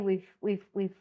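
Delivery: ordinary talk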